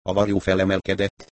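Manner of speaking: usual speaking voice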